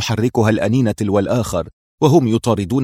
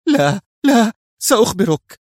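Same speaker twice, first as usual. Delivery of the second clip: very high, frantic cry of surrender; high-pitched desperate yelp; panicked scream; 11 semitones higher, 4 dB louder than usual. A narrator's reading. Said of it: "صرخ بيزو".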